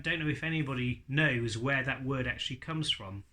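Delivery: normal talking voice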